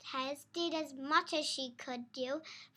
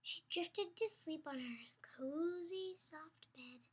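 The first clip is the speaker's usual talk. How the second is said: soft unhurried whisper as she drifts off; sleepy whisper; 9 dB quieter than usual. A narrator's reading. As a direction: lull them toward sleep. Bedtime lull in story.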